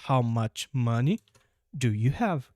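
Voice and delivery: very slow, careful English question; slow model question. Shown as a demonstration. Teacher slowly modelling the corrected question. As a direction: lay out each word for the class. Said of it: "Antes do do".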